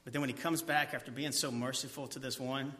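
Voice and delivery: ordinary delivery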